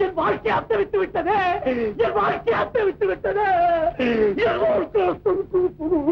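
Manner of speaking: high grieving lament; keen over your ruined life; anguished repeated lament; lamenting wail. Acted. Blind man laments his ruin.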